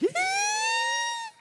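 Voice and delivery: loud squealing laugh burst; big shrieking laugh at own story; loud high laugh. Real, not acted. Laughing at tilting head back.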